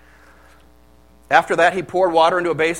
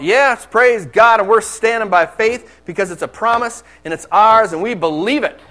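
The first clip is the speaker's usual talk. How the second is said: imitating a bold proclamation; 4 semitones higher, 6 dB louder than usual. Youth pastor proclaiming to the group, loud and bold. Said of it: "making some strong, bold statement".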